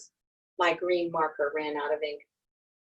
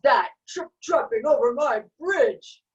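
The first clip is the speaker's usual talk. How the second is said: loud, higher troll shout; thundering troll; 7 semitones higher, 7 dB louder than usual. A narrator's reading. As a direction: boom it out like an angry troll.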